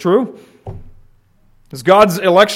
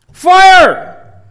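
"Fire!" yelled much higher and louder; shouted alarm; 14 semitones higher, 8 dB louder than usual. Shown as a demonstration. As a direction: scream "Fire!" as a real alarm. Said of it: "Is that just for your information?".

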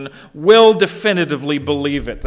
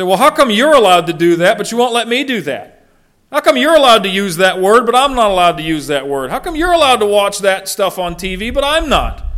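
put-on higher, louder protesting kid voice; child complaining; 5 semitones higher, 6 dB louder than usual. Preacher voicing a child catching a dad's hypocrisy, protesting kid. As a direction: whine it like a kid calling out a parent. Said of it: "They can spot hypocrisy".

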